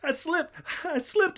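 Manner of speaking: high, loud panicked holler. A narrator's reading. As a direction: cry out in a stammer, very high. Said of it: "I hollered".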